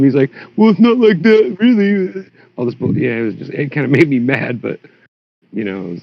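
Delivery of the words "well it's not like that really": higher, put-on sheepish voice of the faker; mock excuse. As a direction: whine a lame excuse, half-hammed. Voicing the caught faker.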